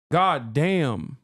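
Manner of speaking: ordinary delivery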